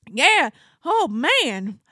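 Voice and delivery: slow, drawn-out Texan; thick Texas accent. Retold or imitated. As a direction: imitate the Texan's weary complaint. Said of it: "he has the thickest Texas accent".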